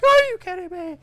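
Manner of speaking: high, loud "Are you kidding me?"; thrilled yell at a hooked trout; excited disbelief. Retold or imitated. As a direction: shout it as the hook sets. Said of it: "And I'm like".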